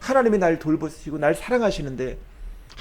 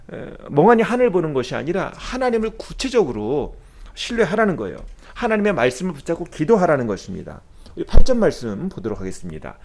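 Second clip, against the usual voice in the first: usual speaking voice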